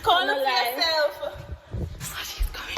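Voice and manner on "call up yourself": loud. Acted. Staged argument, raised voice.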